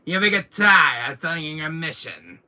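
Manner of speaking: loud, high villain taunt; hammed-up villain taunt; Wesker impression. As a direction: sneer it at him. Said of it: "Doing my Wesker thing".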